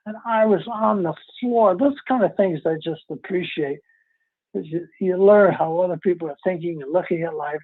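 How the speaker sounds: normal talking voice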